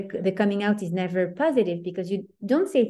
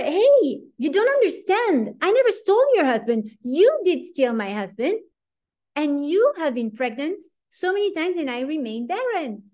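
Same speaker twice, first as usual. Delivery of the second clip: much higher and louder, crying out in protest; a pained, high protest against the accusation; indignant outburst; 8 semitones higher, 3 dB louder than usual. Acted. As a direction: let the hurt burst out at her sister. Rachel's imagined outburst at sister.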